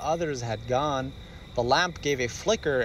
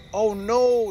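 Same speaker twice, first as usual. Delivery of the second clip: alarmed; 7 semitones higher, 5 dB louder than usual. Acted. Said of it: "Daddy cried".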